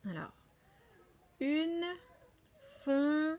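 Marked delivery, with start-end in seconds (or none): 1.4-3.4 "fon-tai-ne" split into three raised, ringing beats; slow dictation of "fontaine", a pause between syllables. Shown as a demonstration. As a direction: break "fontaine" into separate syllables, final e sounded. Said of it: "combien de syllabes avons-nous".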